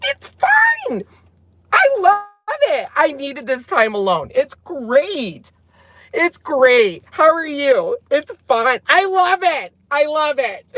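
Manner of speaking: high, strained, fake-bright insisting all is fine; overly chipper, protesting too much; forced cheerful denial. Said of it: "And when you hear this".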